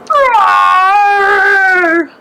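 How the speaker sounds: trapped lion roaring pitifully through the forest. Acted. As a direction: roar in distress.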